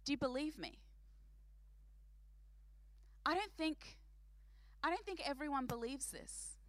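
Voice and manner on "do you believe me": ordinary delivery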